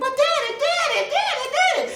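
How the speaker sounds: put-on high pleading girl's voice; a daughter begging her daddy, high and small; little girl voice. Daughter pleading with angry father, high girlish voice.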